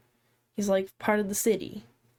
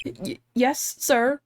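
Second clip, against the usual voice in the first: higher-pitched, flustered stutter; nervous stammer; 5 semitones higher, slower than usual. Acted. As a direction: stumble into a startled, questioning reply. Detective startled by judge's question.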